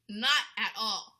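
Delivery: "not at all" drawn out slow and loud. Real, not acted. Vlogger hammers home that the apology was empty, slow and loud. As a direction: punch each word of "not at all" slowly.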